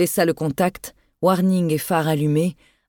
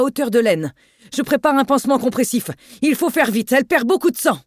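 higher, rushed call over the storm; hurried shouting; 6 semitones higher than usual. Acted. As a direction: shout the report fast into the phone. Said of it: "cria par-dessus le désordre sonore".

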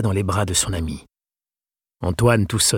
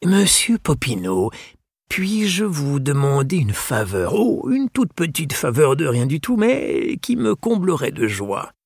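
voix aiguë et lente de vieux gentleman; vieux libraire suppliant; 6 semitones higher than usual. Acted. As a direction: demander une faveur avec une politesse exquise. (le libraire demande humblement une faveur, lent et aigu)

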